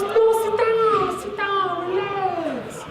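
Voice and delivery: put-on very high, sing-song excited Chinese-accented lady; stretched-out, overjoyed Chinese accent; thick Chinese accent. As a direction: gush like a thrilled Chinese examiner. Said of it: "she had a really thick Chinese accent".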